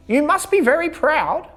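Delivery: high bright sheep; chirpy sheep invites bear; cheerful sheep voice. A narrator's reading. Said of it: "said Sheep".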